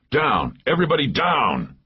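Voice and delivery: high, urgent cry to take cover; urgent warning. A narrator's reading. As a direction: call out a sudden danger warning. Warning as helicopters are about to fire.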